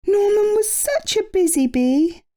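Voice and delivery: high, wistful little bee; Amelia voice. A narrator's reading. Amelia missing Norman, high and wistful. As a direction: sigh fondly for a lost friend. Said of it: "sighed Amelia".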